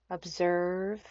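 unhurried meditation guide with long pauses; calm, spacious yoga nidra voice; guided meditation. Acted. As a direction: ease them gently into stillness, phrase by phrase.

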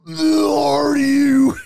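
slow, high, drawn-out mock Joe Rogan; hammed-up imitation of a famous host; Joe Rogan impression. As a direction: stretch it out in a big goofy impersonation. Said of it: "do it in his voice".

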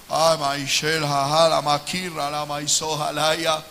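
speaking in tongues. Real, not acted.

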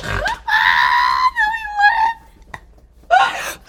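squealed mock denial breaking into laughter; mock shriek. Mocking her friend's denial with a laughing shriek. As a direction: shriek it, then crack up.

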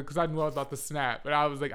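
usual speaking voice

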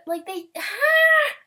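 high loud overwhelmed cry; squeal. Real, not acted. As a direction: let out a delighted squeal.

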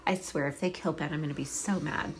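ordinary talk

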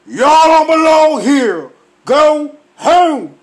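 high loud slowed devil proclamation; Lucifer voice. Pretend. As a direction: boom like Lucifer banishing them. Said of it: "I'm like Lucifer".

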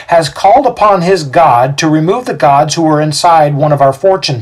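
high, loud denunciation; Xander, fervent accuser. A narrator's reading. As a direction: rouse the crowd against the prisoners.

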